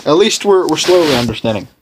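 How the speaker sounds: ordinary talk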